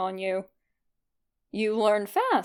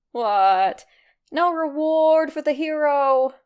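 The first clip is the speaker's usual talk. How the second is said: louder, brash mock-heroic demand; loud, swaggering skeleton boasting; cocky teasing hero; 4 semitones higher, 6 dB louder, slower than usual. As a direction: puff up and fish for praise. Red teasing, fishing for a reward.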